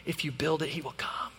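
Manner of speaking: soft, hushed Field of Dreams whisper; hushed movie voice. Retold or imitated. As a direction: hush it like a mystical voice from a movie. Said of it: "kind of voice".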